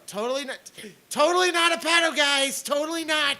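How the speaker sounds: high, loud sarcastic protest; mock denial. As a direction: shout it in an exaggerated, squeaky-high mock defence.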